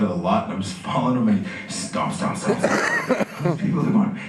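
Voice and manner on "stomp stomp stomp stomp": slow, heavy mock footsteps; stomping. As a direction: drag out each stomp like an angry march. Imitates boy storming off.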